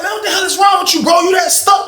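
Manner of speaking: high, quick retold outburst; exasperated confrontation.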